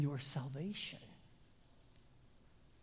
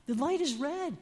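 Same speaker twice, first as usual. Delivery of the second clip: exasperated call, higher and louder; 10 semitones higher, 4 dB louder than usual. Retold or imitated. Preacher retelling yelling at a neighbor who ignores red lights, exasperated. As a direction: yell across the street at him. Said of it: "and I say".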